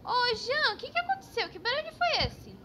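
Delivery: high, anxious calls to a friend; worried, calling out after a crash; alarmed calling. Acted. Coworker calling anxiously to her silent partner.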